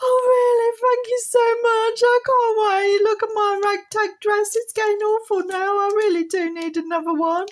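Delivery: high, loud squealing doll, thrilled; Polly doll voice, delighted. Pretend. Doll Polly thanks Paul for a new dress, high excited squeal. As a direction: squeal with joy like a tiny doll getting a present.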